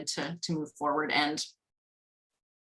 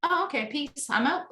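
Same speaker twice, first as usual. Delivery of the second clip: higher, breezy sign-off; overwhelm's casual goodbye; 7 semitones higher than usual. Acted. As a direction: lightly voice a feeling bowing out. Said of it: "it just generally is like".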